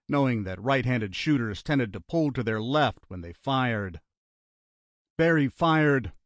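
ordinary delivery, nothing marked

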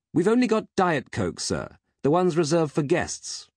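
high-voiced robot servant; mechanized butler. A narrator's reading. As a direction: report dutifully as a robot butler. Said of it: "replied his faithful mechanized friend".

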